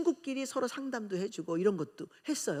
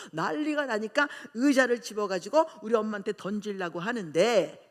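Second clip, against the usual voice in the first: usual speaking voice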